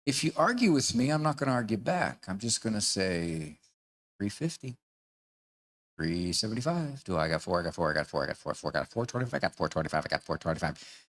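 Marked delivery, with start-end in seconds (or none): 4.2-11.0 low, rapid-fire auction chant; droning auctioneer's bid calling; auctioneer patter. Acted. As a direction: rattle off the bids like an auctioneer, low and quick. Acting auctioneer raising essay length.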